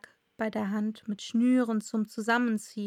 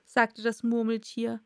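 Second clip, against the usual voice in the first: plain reading voice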